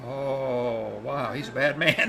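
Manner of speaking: low, drawn-out mock amazement; retold dawning awe. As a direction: let it slowly sink in. Listeners finally grasp the story.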